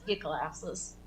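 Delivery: ordinary talk, nothing marked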